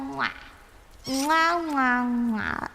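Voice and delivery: blown air kisses; between kisses. Real, not acted. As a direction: smack kisses at the mic. Blowing goodnight kisses to viewers.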